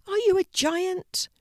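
high small puppy voice asking; wide-eyed little puppy; high puppy question. A narrator's reading. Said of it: "remarked the puppy".

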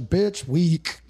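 scornful taunt; mocking dismissal. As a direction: sneer it. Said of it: "I was like".

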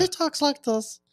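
very high, drawn-out comic voice; unique high voice. Acted. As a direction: squeak it slowly, as a joke voice. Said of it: "he has a very unique voice".

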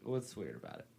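asked low and very soft; quiet question. Real, not acted. Host quietly questions friend about the town, quiet and low. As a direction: softly put the question, pitch down. Question not heard, then repeated.

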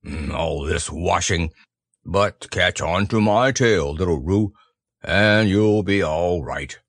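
low, slow gloomy grumble; grumbling to himself. A narrator's reading. Eeyore with his back to the accident, low grumbling. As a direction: grumble along slowly, pitched low. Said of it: "was grumbling quietly to himself".

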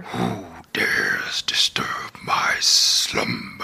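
deep whispered cave growl; slow, hushed, booming ancient cave; Cave of Wonders voice. Shown as a demonstration. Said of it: "That was pretty good".

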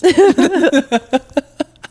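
loud high laughter. Real, not acted. Laughing at a joke.

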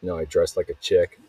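ordinary talk, nothing marked

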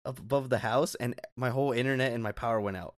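ordinary delivery

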